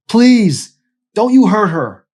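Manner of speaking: very loud, high desperate scream; screamed plea. Acted. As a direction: scream it, begging with everything.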